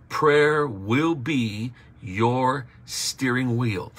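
each word laid down slowly; slow preacher's emphasis; slow, weighty emphasis. Acted. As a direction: drive the key line home slowly. Preacher hammering the key line.

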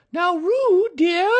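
Kanga voice. A narrator's reading. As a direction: coax a little one in a high sing-song.

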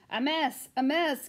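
loud fussing hen; cry. A narrator's reading. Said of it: "cried the other".

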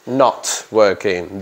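NOT drawn out slowly and hit hard; stress on not. Shown as a demonstration. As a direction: lean long on not. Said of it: "not, not, not". (acento en NOT, lento)

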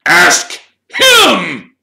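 high, loud, outraged shriek; shrieked accusation. A narrator's reading. Caretaker accusing Harry before the headmaster, shrieking. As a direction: shriek it with a pause between words. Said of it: "shrieked Filch".

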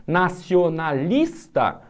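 Modernismo drawn out very slowly, leaning on nis; slow syllable stress. Shown as a demonstration. Teacher stretching a word for students, very slowly. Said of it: "outra palavra paroxítona".

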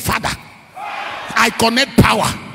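loud, slow, drawn-out cry of faith; shouted prayer. Shown as a demonstration. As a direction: shout it out to lead the church. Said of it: "shout it".

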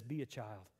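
soft, tender voice; gentle Jesus. Retold or imitated. Jesus speaking to his followers, soft and tender. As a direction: speak it gently like a caring father. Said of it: "Jesus says".

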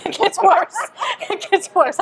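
high-pitched, through real laughter; laughing warning. Real, not acted. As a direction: laugh while promising there's worse to come. Laughs warning listeners of worse.

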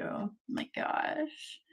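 slow hushed whisper of delight; awed whisper. Real, not acted. Admiring the matching stickers, hushed awe. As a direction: breathe it out, amazed.

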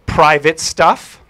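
slow, loud and firm; guarded reply. Retold or imitated. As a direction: spell out the boundary slowly. Girl guards her book.

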